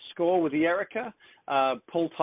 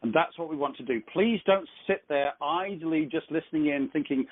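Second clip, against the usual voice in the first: ordinary talk, nothing marked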